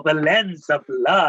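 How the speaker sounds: slow, amused repeat of the title through a laugh. Real, not acted. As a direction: chuckle it out as a joke.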